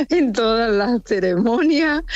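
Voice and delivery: high laugh spilling into the words. Real, not acted. Critic telling hosts about a fidgety prizewinner, laughing. Amused by a restless young actor.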